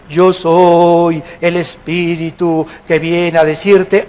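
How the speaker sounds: slow, drawn-out spook voice; ghostly spirit. Acted. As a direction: stretch the words like a mock ghost. Imitates a spirit appearing at night.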